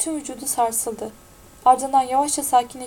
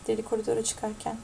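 plain reading voice

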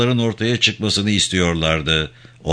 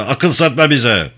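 raised, higher voice; angry shout; 4 semitones higher, 4 dB louder than usual. A narrator's reading. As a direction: shout him down. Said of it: "diye bağırdı".

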